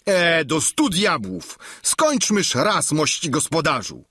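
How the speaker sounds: exasperated cry. Acted. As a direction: cry out in impatience at the host. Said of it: "zawołał Sancho".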